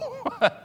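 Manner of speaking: short squeaky laugh; high laugh. Real, not acted. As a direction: let a laugh escape. After mock whimper.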